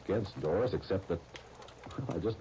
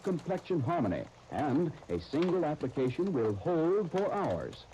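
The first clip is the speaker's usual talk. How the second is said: smooth, persuasive commercial pitch; radio ad read; 8 semitones higher, slower than usual. Acted. Announcer selling lipstick to listeners, smooth and persuasive. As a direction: sell the lipstick with polish.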